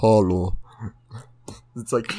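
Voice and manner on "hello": low Indian-accented greeting, imitating a shop clerk; thick Indian accent. Friends greeting each other like that guy, thick Indian accent. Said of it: "taken that guy's accent".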